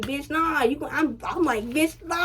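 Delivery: high, tense mock protest; pained protest. Telling friends how she refused him, high pained protest. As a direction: squeal a refusal in pain.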